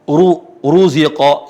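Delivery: heavy Arabic ru; thick ru. Shown as a demonstration. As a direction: sound Ruziqa with a full, heavy ru. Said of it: "dibaca tebal".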